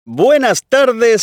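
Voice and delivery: big, slow game show welcome; booming prize announcer. Acted. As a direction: stretch it out like a TV host opening the show. Quiz host opens the show.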